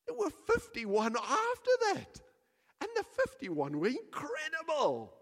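high, bright dismissal of the bad week; dismissive couple. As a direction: wave away the bad week cheerfully. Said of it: "and they would go".

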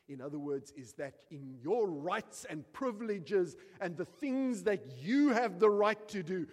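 loud, high, driving sermon delivery; fiery preaching. Acted. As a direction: preach it out with full force. Preaching at full force.

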